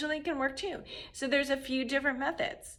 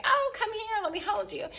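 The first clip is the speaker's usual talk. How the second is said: a lightly voiced, much higher cooing mom; doting mom; 11 semitones higher than usual. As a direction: coo it like an overly tender mother. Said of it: "that mom who's like".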